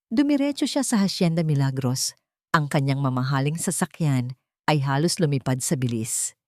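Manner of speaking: plain reading voice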